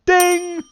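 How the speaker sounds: high bell ding, loud; ping it out like a bell. Pretend. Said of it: "edit in this sound effect of a bell".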